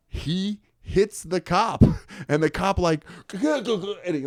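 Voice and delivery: high, slow words through laughter; real laughter breaking into the story; laughing while telling. Real, not acted. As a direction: crack up as you tell it. Cracks up telling the crash.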